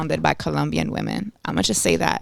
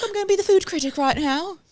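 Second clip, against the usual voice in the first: high-pitched put-on imitation; mock food critic; 11 semitones higher than usual. Teasing her for playing food critic, high mimicking voice. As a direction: mimic her in a much higher voice. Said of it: "She's like".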